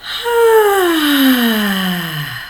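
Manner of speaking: long gentle sigh of contentment; relaxed, satisfied exhale; contented sigh. Shown as a demonstration. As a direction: let out a soft, easy sigh. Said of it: "a very gentle and contented".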